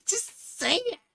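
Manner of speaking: high mock squeal of impatience; mock impatient high voice. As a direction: squeak it out, exasperated. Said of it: "I'm like".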